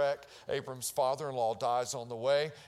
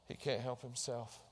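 ordinary talk

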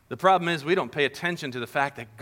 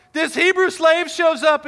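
"this Hebrew slave" cried out much higher and louder, rising; outraged accusation; 13 semitones higher, 7 dB louder than usual. Acted. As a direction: play the wronged wife shouting to the servants. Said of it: "Let me show you".

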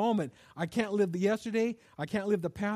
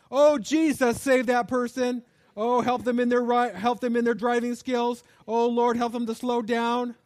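loud exclaimed prayer, slower, hammed-up; mock driver's prayer; 3 semitones higher, 6 dB louder than usual. Praying aloud after being cut off in traffic, mock loud. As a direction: pray loudly at a rude driver. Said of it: "someone cut you off".

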